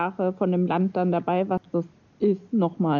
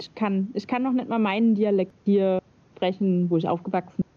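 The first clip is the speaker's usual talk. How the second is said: normal talking voice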